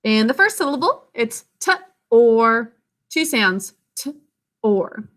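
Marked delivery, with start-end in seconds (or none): 1.1-5.1 "t" and "or" split apart, slow and separate; sounding out syllables. Shown as a demonstration. Teacher sounding out "t" "or" slowly for the class. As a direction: break "t ... or" into its sounds. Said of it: "Sound it out with me".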